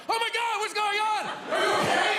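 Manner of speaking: very high, startled wife voice; comic high-pitched panic; alarmed wife. Acted. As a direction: play a wife jolted awake in alarm. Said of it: "so my wife was like".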